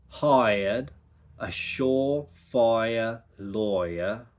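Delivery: slow, deliberate dialect practice line; practice sentence. Shown as a demonstration. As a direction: model the sentence slowly, word by word. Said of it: "The practice sentence is".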